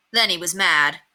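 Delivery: ordinary delivery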